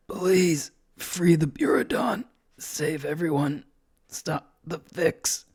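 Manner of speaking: high, slow, whispery dying plea; very weak voice. A narrator's reading. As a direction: force out words with fading strength. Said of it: "His voice is so weak".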